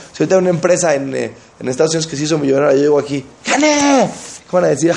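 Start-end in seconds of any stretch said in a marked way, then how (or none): 3.5-4.1 high-pitched victory cry; imitated exultant boast; mock triumph. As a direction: crow it like a winning fan. Acting a fan claiming a win.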